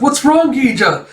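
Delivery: high, loud worried call; acted alarm. Friend answers a scream, alarmed.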